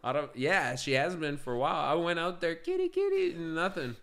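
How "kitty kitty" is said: calling the cat. Retold or imitated.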